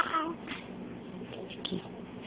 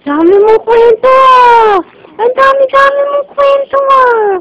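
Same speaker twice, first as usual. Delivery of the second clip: much higher and louder, delighted singsong; 10 semitones higher, 6 dB louder than usual. Real, not acted. Grandmother gushing at the babbling baby, high excited baby talk.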